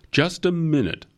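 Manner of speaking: high-pitched sudden interjection; cutting in. A narrator's reading. Company head halting a junior lawyer's dismissal, cutting in. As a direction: jump in with the pitch thrown up.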